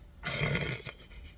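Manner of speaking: breathy, voiceless chuckle; laugh. Real, not acted. Vlogger amused by her makeshift blindfold, breathy laugh. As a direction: wheeze out a short laugh.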